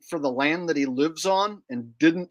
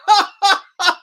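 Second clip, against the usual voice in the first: loud, high, crying laughter; loud mocking laugh; 9 dB louder than usual.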